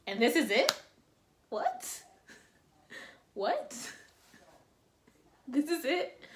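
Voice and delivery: high, rising disbelief, played for laughs; mock incredulous. Girl underwhelmed by her crush's first kiss, mock incredulous. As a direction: punch it as an incredulous complaint.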